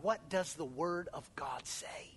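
pointed whisper. Acted. Pastor pressing the church, whispered. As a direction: whisper the real question.

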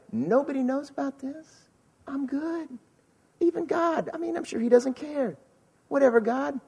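high, breezy voice of someone fooling themselves; mock self-assurance. Sinner convincing themselves they can get away with it, mock self-assured.

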